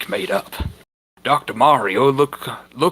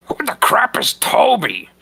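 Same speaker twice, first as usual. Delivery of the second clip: loud, much higher, shouted; indignant yell; 9 semitones higher, 5 dB louder than usual. Acted. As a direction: bellow it like an offended wrestler. Mexican wrestler yells, voiced.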